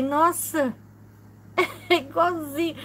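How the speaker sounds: high, laughing amazement; amazed laugh. Retold or imitated. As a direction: gasp at the likeness through a laugh. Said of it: "Eu falei".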